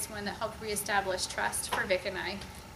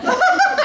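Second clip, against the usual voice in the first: high burst of laughter; 13 dB louder than usual. Real, not acted. Laughing after the punchline.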